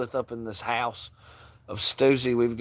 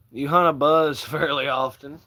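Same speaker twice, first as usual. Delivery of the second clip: ordinary talk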